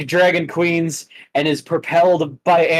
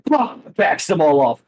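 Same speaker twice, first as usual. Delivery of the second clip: ordinary talk, nothing marked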